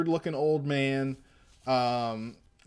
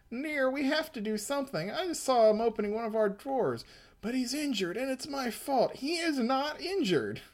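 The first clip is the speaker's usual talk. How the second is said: pitched way up, fast and fretful; high comic voice; 10 semitones higher than usual. Acted. Reading the comic aloud, voicing the worried kid high.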